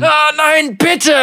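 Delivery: high screaming plea for mercy; terrified horror victim. A narrator's reading. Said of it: "schrie Devaki".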